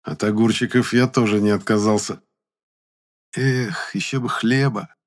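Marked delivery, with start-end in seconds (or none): 3.3-4.9 higher, wistful sigh of longing; dreamy. A narrator's reading. Hungry boy eating sausage in cellar, dreamy. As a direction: wish for it dreamily. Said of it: "мечтательно сказал я".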